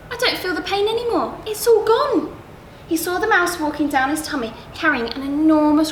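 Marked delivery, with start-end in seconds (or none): 0.1-2.4 relieved surprise. Acted. Crocodile relieved of pain.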